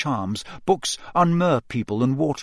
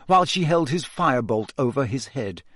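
ordinary delivery, nothing marked

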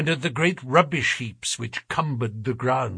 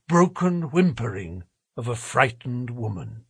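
usual speaking voice

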